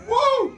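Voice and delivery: sudden yelp from the heat; high pained whoa. Real, not acted. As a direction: squeal it out high. Reacting to spicy wings.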